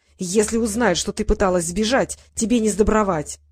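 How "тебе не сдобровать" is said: usual speaking voice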